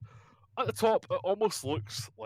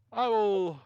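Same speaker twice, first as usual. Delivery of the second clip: higher, louder promise; sorceress voice; 8 semitones higher, 5 dB louder than usual. Acted. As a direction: lift the voice as she promises. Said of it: "she goes".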